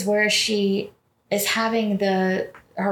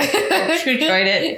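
slower, higher, through laughter; laughing mock sign-off; 6 semitones higher, 4 dB louder than usual. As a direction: joke farewell, cracking up. Joking farewell amid laughter.

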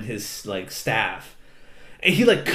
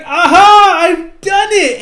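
high, loud, drawn-out gloating cry; hammed-up victory shout; mock triumphant crow; 12 dB louder, slower than usual. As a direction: crow like a triumphant cartoon kid. Acts out kid's triumphant arrival.

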